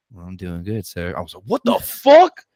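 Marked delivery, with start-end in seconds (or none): 0.1-1.1 ghostly woman's voice. Retold or imitated. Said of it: "I heard this woman's voice say something". 1.5-2.4 high, loud startled cry; freaked-out shout. Retold or imitated. In the restroom, startled by a ghost's reply, shrieking. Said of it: "I freaked out".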